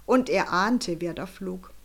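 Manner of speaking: ordinary delivery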